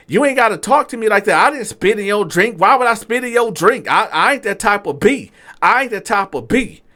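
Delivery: high, rapid-fire acted outrage; indignant protest. Waiter defending himself to the accusing customer, indignant protest.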